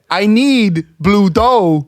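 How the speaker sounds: slow, deadpan Walken line with odd stress; Christopher Walken impression. Shown as a demonstration. [name] doing Walken at a Domino's counter. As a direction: drag each word out like Walken. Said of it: "I can only say those two lines".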